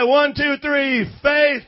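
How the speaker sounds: loud count-in; shouted count. Shown as a demonstration. Pastor counting the congregation in. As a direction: shout the cue to start. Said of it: "now you say it".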